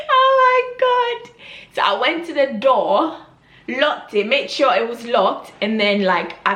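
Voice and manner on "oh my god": high, drawn-out, laughing squeal; helpless high-pitched laughing exclamation. Real, not acted. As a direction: squeal it slowly through real laughter. Laughing at her embarrassing memory.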